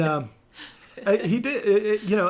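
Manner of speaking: ordinary talk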